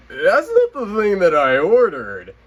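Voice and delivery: high, loud outraged disbelief; high indignant shriek of disbelief; shocked disbelief. Retold or imitated. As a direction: squeal that it's the wrong thing. Shocked at the wrong plushie.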